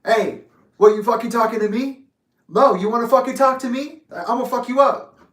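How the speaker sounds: acted stranger squaring up, loud and high; street confrontation. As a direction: loudly threaten like someone starting a street fight. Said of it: "somebody's like".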